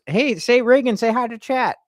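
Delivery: high, loud pet-coaxing voice; calling a pet. Real, not acted. Streamer telling the cat to greet chat, high coaxing call. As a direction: pitch it up to coax the cat.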